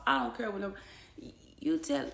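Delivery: ordinary delivery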